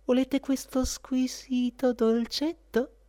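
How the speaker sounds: high, slow, sugary lady's offer; first court lady. Acted. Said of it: "chiese la prima damigella".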